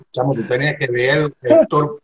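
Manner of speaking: ordinary talk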